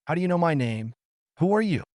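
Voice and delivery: startled demand. A narrator's reading. Narrator challenges mysterious woman.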